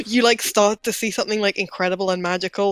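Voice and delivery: ordinary talk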